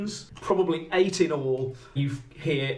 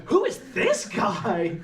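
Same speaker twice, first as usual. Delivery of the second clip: slow, higher, tickled question; amused curiosity, pitched up; Alison; 6 semitones higher, slower than usual. Acted. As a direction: coo over a new arrival, drawn out. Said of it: "And Alison goes".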